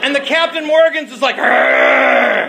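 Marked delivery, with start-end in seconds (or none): 1.4-2.5 very low, loud retching growl; retching arr. Retold or imitated. Rum coming back up, loud low retching growl.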